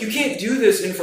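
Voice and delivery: high, insistent re-enacted objection; retold urgent protest.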